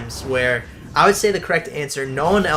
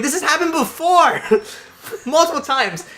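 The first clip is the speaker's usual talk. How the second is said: much higher and louder, pointed; exasperated retort; 13 semitones higher, 3 dB louder than usual. Retold or imitated. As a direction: insist it keeps happening. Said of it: "I'm like".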